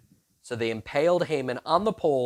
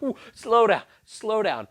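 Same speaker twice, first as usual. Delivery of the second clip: mock panic; 5 semitones higher, 5 dB louder than usual. Haman panicking.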